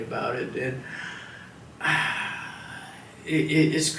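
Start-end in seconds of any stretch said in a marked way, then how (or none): 1.8-2.8 long, breathy exhale; heavy sigh. Real, not acted. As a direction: let out a long sigh before going on. Pauses before admitting stigma bothered him.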